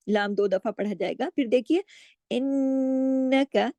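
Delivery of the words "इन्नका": इन्नका with the doubled nun held through the nose, slow; nasal ghunna. Shown as a demonstration. Teacher shows students the full ghunna, slow. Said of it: "नून पे गुन्ना होगा".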